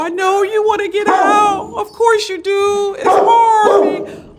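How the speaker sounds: loud, high consoling voice; talking to a dog. Real, not acted. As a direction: call comfort to a caged dog.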